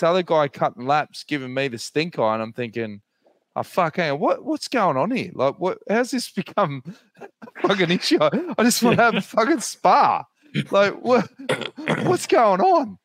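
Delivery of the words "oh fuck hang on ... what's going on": retold exasperation. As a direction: build from muttered disbelief to an exasperated rant. Retold inner outrage at spa.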